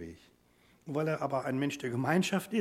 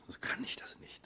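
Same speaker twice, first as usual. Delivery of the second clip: whisper; 10 dB quieter than usual. Real, not acted. Preacher drops to a hush.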